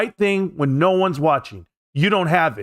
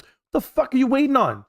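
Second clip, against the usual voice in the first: ordinary talk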